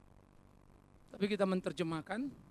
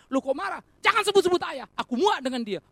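loud, higher, indignant outburst; acted angry outburst; 7 semitones higher, 7 dB louder than usual. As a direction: snap back like a resentful son. Acted angry listener hating father. (marah meledak, keras dan tinggi)

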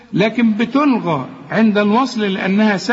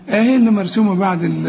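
normal talking voice

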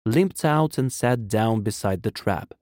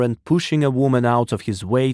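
plain reading voice

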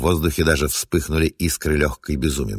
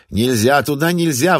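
higher, louder cry; shouting; 9 semitones higher, 4 dB louder than usual. Acted. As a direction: shout to stop someone at the door. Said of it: "послышался крик Хвеси".

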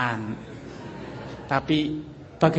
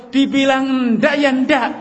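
loud, slow, higher final no; stubborn angry refusal; 8 semitones higher, 6 dB louder, slower than usual. Acted. Husband shutting down his wife's request, loud and stubborn. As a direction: slam the door on the discussion.

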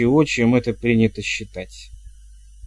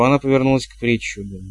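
usual speaking voice

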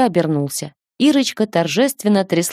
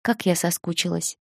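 much softer, close and intimate; whisper; 7 dB quieter than usual. A narrator's reading. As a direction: whisper it into his back. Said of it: "прошептала". (нежный шёпот)

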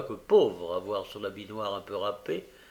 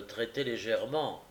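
ordinary delivery

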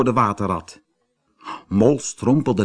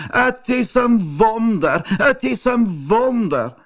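amazed; 11 semitones higher than usual. A narrator's reading. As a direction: marvel aloud, stretching each phrase up high. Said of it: "viel achterover van verbazing". (verbaasde, hoge uitroep)

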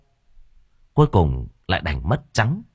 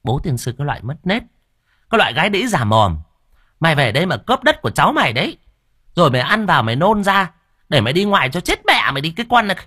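fast, higher, louder tirade; furious cursing; 7 semitones higher, 4 dB louder, faster than usual. Acted. Hương curses her sister-in-law over the land, fast angry tirade. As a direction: hands on hips, spit the curses out. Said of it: "tức lắm, chống nạnh mà trời".

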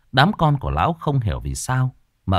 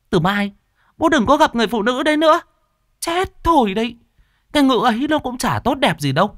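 much higher, louder shrill-voiced daughter, fast; daughter berating father; 3 dB louder than usual. A narrator's reading. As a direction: berate him with a high-pitched voice. Con cái cãi vã với bố. (con gái quát bố giọng the thé)

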